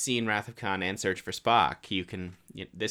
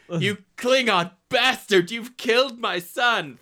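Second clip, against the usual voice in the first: slow, louder, higher anguished roar on Klingon; Kirk rage; 10 semitones higher, 7 dB louder, slower than usual. Shown as a demonstration. Kirk raging at the Klingon who killed his son, slow and loud. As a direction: drag out Klingon bastard in fury. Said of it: "Third time for good measure".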